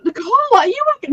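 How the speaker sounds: quick, high-pitched imitation of a worried exclamation; exclamation-mark alarm. Friend checking on streamer after hearing her morning voice, mock alarmed. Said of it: "Nicole, exclamation marks".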